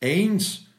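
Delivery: little clock striking the hour; watch chiming. A narrator's reading. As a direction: chime it out like a small watch. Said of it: "sagte die Uhr seiner Frau".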